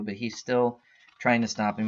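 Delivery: ordinary talk, nothing marked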